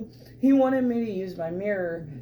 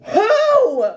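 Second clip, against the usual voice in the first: much higher, louder confused yell; baffled shout; 8 dB louder than usual. Retold or imitated. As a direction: yell it, totally lost. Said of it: "And Henry's like".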